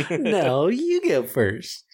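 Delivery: giggly late night call. Pretend. Couple's phone goodbye parody.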